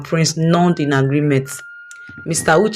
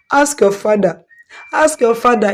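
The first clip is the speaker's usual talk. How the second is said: tearful, raised pitch; crying; 5 semitones higher than usual. Acted. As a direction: cry out the accusation. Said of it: "still crying".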